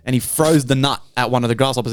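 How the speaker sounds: usual speaking voice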